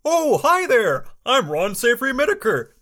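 high, hearty character greeting; Ron Savory Whitaker. Acted. Ron greeting listeners at his workshop, high character voice. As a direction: lift the hello up high. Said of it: "but tone it down".

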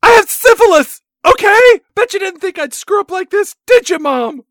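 high, loud furious scream; a daughter screaming her confession at her mom. Acted. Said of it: "she screamed that she has syphilis".

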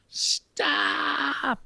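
high sharp shouted stop; a real shout of stop across the set; yelled stop. Retold or imitated. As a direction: yell it to halt two cars. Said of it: "And I yell".

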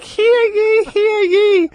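put-on high, loud kid crier; town crier. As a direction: shout it like a little newsboy. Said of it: "The little kid's like".

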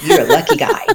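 high-pitched teasing with laughter; laughing playful retort; joking, amused. Retold or imitated. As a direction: tease him, voice climbing into a laugh.

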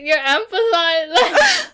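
slow, high, laughing shout. Real, not acted. Laughing at the mispronunciation.

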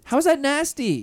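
high-pitched indignant question; protest, taking it personally; offended disbelief. Real, not acted. Host defends bag from friend's disgust, offended.

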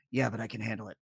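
low, soft understated Batman; lightly voiced Batman, downplaying it; calm Batman. Telling a friend about Batman, low soft Batman line.

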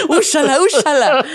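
high laugh spilling into use it, use it; laughing urging. Real, not acted. Teasing a friend about the burnt sage, laughing through it. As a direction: giggle while egging him on.

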